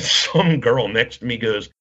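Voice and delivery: normal talking voice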